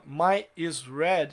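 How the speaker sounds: my is red said slowly as the wrong form; stretched, deliberate wrong version; slow wrong example. Shown as a demonstration. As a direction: spell out the mistake "My is red" slowly. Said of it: "está errado".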